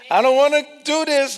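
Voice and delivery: high loud whiny refusal; reluctant whine. Acted. Preacher voices believer refusing God, loud whine.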